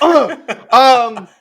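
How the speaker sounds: high, loud, panicky filler; hammed-up awkward hesitation; flustered stalling. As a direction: play a cornered dad stalling. Acted dad caught out by question.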